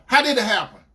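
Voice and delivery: dead serious. Retold or imitated. Boyfriend repeating his demand, dead serious. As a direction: insist on getting the answer. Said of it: "dead serious".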